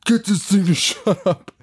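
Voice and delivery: a touch of irritated bossiness; mock annoyed demand. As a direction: snap at the noise. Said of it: "O D is like".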